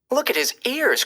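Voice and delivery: high, mocking child's call; teasing cry. A narrator's reading. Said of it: "cried one boy".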